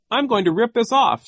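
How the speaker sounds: high, loud playful announcement; pretend joking. A narrator's reading. Violet reaches for his turban, loudly pretending to joke. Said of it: "as if she were still joking".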